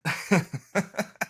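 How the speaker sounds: bursting into high laughter. Real, not acted. Big laugh at joke.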